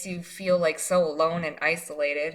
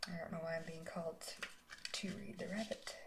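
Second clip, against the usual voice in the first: breathy private whisper, barely voiced; whisper to herself; 19 dB quieter than usual. Real, not acted. Reader wondering aloud to herself over the card, whispered. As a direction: confide it under your breath like a puzzled aside.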